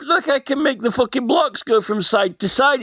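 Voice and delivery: normal talking voice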